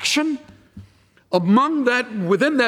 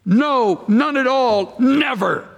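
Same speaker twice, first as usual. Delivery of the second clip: higher, punched "No", "none at all", "never", each set apart; emphatic; 5 semitones higher, slower than usual. Shown as a demonstration. Preacher showing the Greek word's force, emphatic. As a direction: hammer "No" and "never" as flat refusals.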